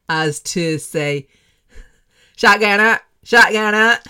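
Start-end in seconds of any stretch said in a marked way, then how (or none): 2.4-4.0 loud, high street-slang radio shout-out. Retold or imitated. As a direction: belt out the presenter's slangy shout-out. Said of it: "his language went so far as to say".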